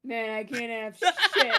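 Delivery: slow, loud words breaking into laughter; laughing. Real, not acted. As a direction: drag it out while cracking up. Cracking up at the joke.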